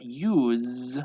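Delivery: "use" with a long buzzing z; slow "use", sustained z ending; Z sound. Shown as a demonstration. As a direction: draw out the voiced z in "use". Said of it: "has more of a Z sound".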